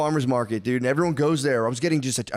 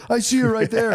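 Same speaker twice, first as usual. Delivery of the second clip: put-on much higher, louder child protest; whiny kid voice; 10 semitones higher, 4 dB louder than usual. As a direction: point and whine like a little kid.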